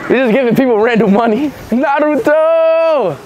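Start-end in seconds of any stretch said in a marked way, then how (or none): 1.7-3.2 long stretched excited call of Naruto; drawn-out shout. Real, not acted. Fan calling out his hero's name, drawn-out shout. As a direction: belt the name out slowly like a fan.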